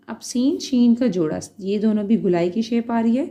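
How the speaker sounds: ordinary talk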